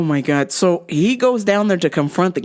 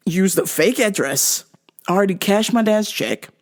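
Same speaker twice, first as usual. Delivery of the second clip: usual speaking voice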